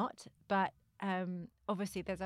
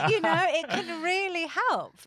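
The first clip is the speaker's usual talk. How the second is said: laughing, higher and louder; 9 semitones higher, 10 dB louder than usual. Real, not acted. Defending mediums to the host, laughing loudly. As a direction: laugh while making the point. Laughing after hocus pocus remark.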